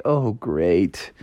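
low, soft letdown; quietly dropping in disappointment; deflated grumble. Acted. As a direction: sigh it out, unimpressed. Frog disappointed by alien call.

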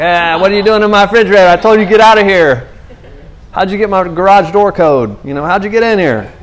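high, loud, fast scolding; annoyed homeowner. Retold or imitated. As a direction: shoo the pesky kid out of your fridge. Angry homeowner at neighbor's kid.

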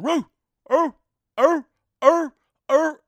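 high loud barking roo er er; imitated dog bark. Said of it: "Fluffy's barking".

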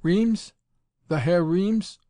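high, loud startled Reames?; stammering surprise. A narrator's reading. Von Holtz startled to learn who Tommy is, high and stammering. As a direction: caught off guard, stumbling. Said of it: "Von Holtz stammered".

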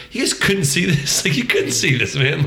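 half-laughing at an obvious blooper; a light touch of annoyance; incredulous disbelief. Retold or imitated. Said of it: "and I'm like".

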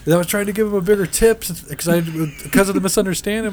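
ordinary delivery

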